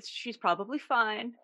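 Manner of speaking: like my mom. Retold or imitated. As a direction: brush it off the way mom would. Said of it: "I'm totally my mom".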